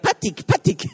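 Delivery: playful imitation of a little kid; little daughter calling. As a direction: mimic a small child calling for her babysitter. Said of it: "die läuft nur noch rum und sagt".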